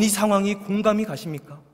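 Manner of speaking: normal talking voice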